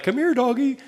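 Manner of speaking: high-pitched dog call. Acted. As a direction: coax a dog you have never met. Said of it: "if I call your dog".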